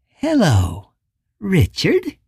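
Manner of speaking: friendly on top, menace underneath; soft menacing voice. Acted. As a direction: greet him sweetly, but threaten. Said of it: "It was a soft and friendly voice, and yet deeply menacing".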